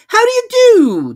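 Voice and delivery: very high, loud, slow hoot-like greeting; a pompous owl calling from the air. A narrator's reading. Owl voiced in story.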